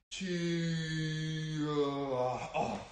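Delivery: long drawn-out karate cry; slow-motion kiai. Shown as a demonstration. Coach punching in slow motion with kids, slow-motion kiai. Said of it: "I like that slow motion key out".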